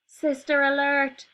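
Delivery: high, loud, slow warning cry; alarmed cry. Acted. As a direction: sound the alarm. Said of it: "cried the rhino".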